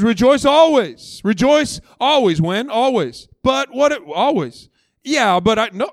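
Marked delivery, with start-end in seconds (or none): 0.0-2.4 loud, higher, slow proclamation; preached emphasis. Acted. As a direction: hammer out each word like a refrain. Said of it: "It says".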